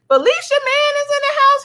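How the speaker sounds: high, sing-song welcome; excited announcement. Real, not acted. Streamer hyping a viewer into the stream, excited. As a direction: hype her arrival like an emcee.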